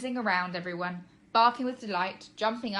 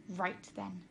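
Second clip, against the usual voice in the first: hissed; 7 dB quieter than usual. Acted. Mum hisses at the boy, quietly furious. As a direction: hiss it with barely held anger. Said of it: "she hissed".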